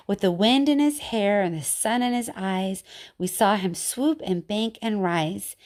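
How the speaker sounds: usual speaking voice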